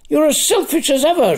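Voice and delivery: high, fast, shrill accusing shriek. A narrator's reading. Rimmer accuses Lanark as the city burns, shrieking. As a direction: fling it at him in a high shriek. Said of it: "shrieked Rimmer".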